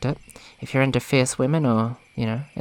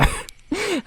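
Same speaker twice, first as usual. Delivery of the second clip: 1 amused laugh. Real, not acted. Teasing the characters' romance.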